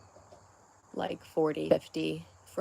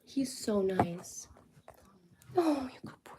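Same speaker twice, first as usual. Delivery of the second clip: soft whisper, much softer; whisper to dog; 6 semitones higher, 9 dB quieter than usual. Real, not acted.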